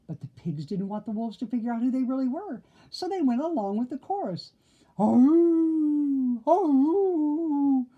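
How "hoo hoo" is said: loud high howling hoo; wolf howl. A narrator's reading. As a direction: howl at the moon. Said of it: "the wolves howled".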